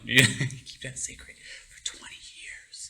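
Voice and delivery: whispered, laughing confession. Real, not acted. Admitting he hid the story from his brothers, laughing whisper. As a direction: let it slip like a secret. Confessing a long-kept secret.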